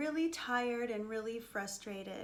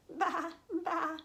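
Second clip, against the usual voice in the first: higher imitated bleats; little lambs bleating; 5 semitones higher than usual. Lambs answer the country mouse, higher bleating. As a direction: bleat like a pair of small lambs. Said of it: "said the little lambs".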